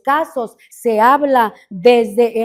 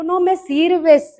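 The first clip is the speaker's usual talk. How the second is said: mock harsh rejection; 6 semitones higher, 4 dB louder, slower than usual. Rejection God did not say.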